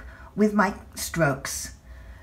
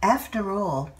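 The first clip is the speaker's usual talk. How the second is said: usual speaking voice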